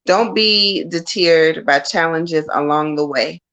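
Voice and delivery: slow, measured reading aloud; slow verbatim reading. Shown as a demonstration. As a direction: read the card message word by word. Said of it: "let me read it verbatim".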